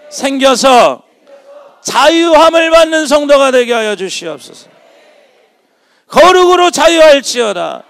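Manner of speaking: loud, high, shouting preacher's climax; fiery preaching. Acted. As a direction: preach it out with full force. Preaching at full force. (목청껏 외치는 설교)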